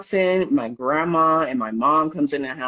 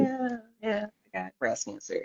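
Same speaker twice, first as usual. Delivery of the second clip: offhand; 6 semitones higher, 9 dB quieter than usual. Retold or imitated. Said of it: "I don't wanna make it a big deal".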